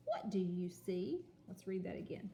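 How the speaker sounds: ordinary delivery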